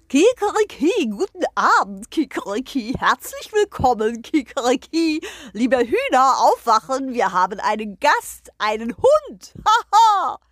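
crowing rooster voice, loud and pompous; proud rooster. Acted. The rooster greets the hens and the guest, proud crowing voice. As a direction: strut and crow like a proud rooster.

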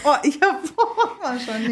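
high laughing groan of disgust. Real, not acted. Friend reacting to a shocking story with a laugh of disgust. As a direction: laugh it off in disbelief.

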